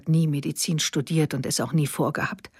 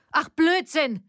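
scornful cry, much higher; shout; 10 semitones higher, slower than usual. A narrator's reading. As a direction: fling it back at her mother. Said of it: "schrie Silvia jetzt".

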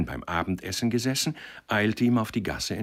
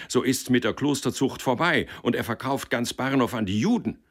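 high-pitched heated warning; shouting faction; 6 semitones higher than usual. A narrator's reading. One faction warning against Valerian, called out. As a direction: argue it in a raised, high voice. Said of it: "riefen die einen".

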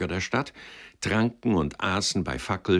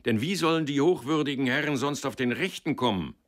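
booming, much higher and louder bellow; stentorian shout; 7 semitones higher, 4 dB louder than usual. A narrator's reading. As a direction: roar at the crowd like a gatekeeper. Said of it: "rief dann mit Stentorstimme".

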